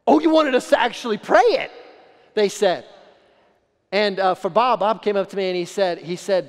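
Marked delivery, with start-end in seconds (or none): 0.1-1.7 high, loud, mock-astonished realization; retold friends suddenly getting it, in mock surprise. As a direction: play the friends caught off guard, hammed up.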